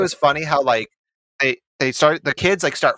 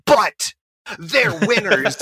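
mock protest; 7 semitones higher, much slower than usual. Acted quote opening the stretch.

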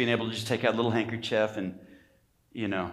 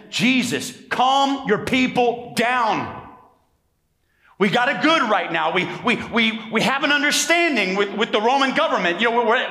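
raised, anxious pleading to Jesus; nervous Pharisees; 11 semitones higher, 5 dB louder than usual. Retold or imitated. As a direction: play the jittery Pharisees begging him to calm things. Preacher voices nervous Pharisees to Jesus.

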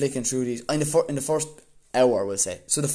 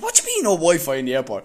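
fast, loud, higher mock outrage; exasperated retort; 5 semitones higher, 7 dB louder, faster than usual. To the airport clerk, retold exasperated disbelief. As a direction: fire it back at her, incredulous.

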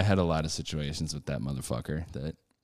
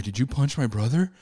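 raised pitch, rising challenge; retold confrontation; 6 semitones higher than usual. Brother confronting the puncher, mock accusing. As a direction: ask it as a high, rising accusation.

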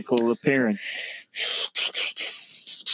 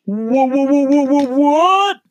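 rising, stuttering what; stammering disbelief; 11 semitones higher, 5 dB louder than usual. Acted. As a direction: stutter up to a high, loud what.

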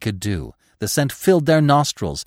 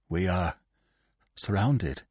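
softer, drained and toneless; lifeless voice; 5 semitones lower, 8 dB quieter than usual. A narrator's reading. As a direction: speak as if all hope is gone. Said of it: "he said in a lifeless voice".

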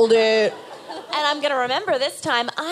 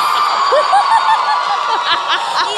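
high excited cackle; loud cackling laugh; 14 semitones higher, 7 dB louder than usual. Real, not acted. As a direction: burst out laughing. Crowd cheers, big laugh.

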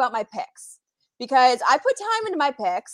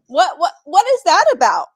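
playfully demanding, higher and louder; mock jealous confrontation; 5 semitones higher, 8 dB louder than usual. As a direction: play at confronting a cheating boyfriend.

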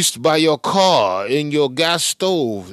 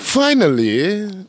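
slow, higher drawn-out parroting; mocking halting echo; 4 semitones higher, much slower than usual. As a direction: stretch it out like you can't get it out. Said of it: "you can't even get the words out".

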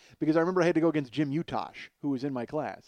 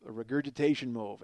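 normal talking voice

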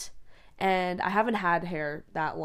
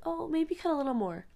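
put-on little girl voice; 9 semitones higher than usual. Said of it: "And I was like".

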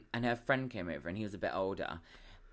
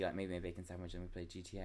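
ordinary talk, nothing marked

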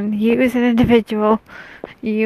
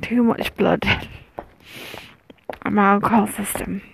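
whispering; 4 dB quieter than usual. Real, not acted. Whispering past houses.

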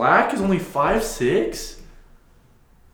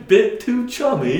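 loud, high British quip; mock British accent; 8 semitones higher, 6 dB louder than usual. Friends mocking British chumminess, in a loud high British accent. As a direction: chirp it like a cheeky Brit.

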